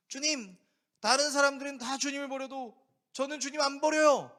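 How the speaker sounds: loud, high boastful vow; eager Peter. Acted. Peter protesting loyalty to Jesus, loud and high. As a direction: insist proudly you'll never leave.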